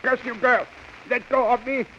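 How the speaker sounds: high-pitched furious protest; angry struggle. Acted. Guard struggling with the girl to reach the radio, angry high voice. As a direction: curse and demand to be released.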